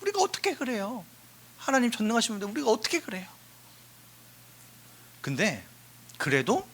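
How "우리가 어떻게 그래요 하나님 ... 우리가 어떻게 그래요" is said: quick, softer, higher excuse; timid refusal. Retold or imitated. Believers excusing themselves to God, quick and soft. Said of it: "우리는 계속 이런단 말이야".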